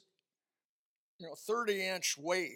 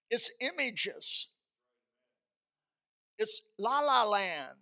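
voicing the stunned wife, pitch climbing higher; hurt wife pleading; 4 semitones higher than usual. Preacher voicing the wife confronting her husband, hurt and higher.

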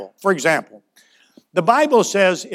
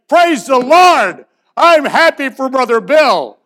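mock gushing praise; 10 semitones higher, 6 dB louder than usual. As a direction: overdo the churchy delight. Congregation's mock joy at Ferrari.

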